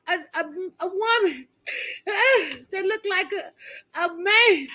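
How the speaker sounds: high, hammed-up sobbing speech; put-on bawling, broken up by sobs; mock crying. Shown as a demonstration. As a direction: choke the words out between fake wails. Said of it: "I'm in here crying for y'all".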